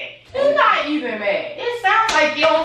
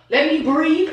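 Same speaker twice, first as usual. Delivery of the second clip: ordinary talk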